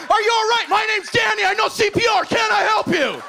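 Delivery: overeager: all smiles, offering help; eager helpful greeting. Retold or imitated. Comic offering help to a stranger, high and eager.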